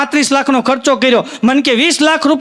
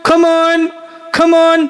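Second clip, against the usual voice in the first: drowning man's yell; 4 semitones higher, much slower than usual. Retold or imitated. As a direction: call out as if being swept away. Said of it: "રાડ્યું પાડતો હતો".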